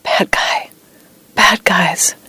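unvoiced nightmare whisper. A narrator's reading. Veteran asleep in bed beside his wife, whispering. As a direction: whisper it as if in a bad dream. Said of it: "thrashing in bed and whispering".

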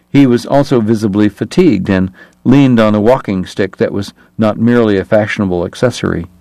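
ordinary delivery, nothing marked

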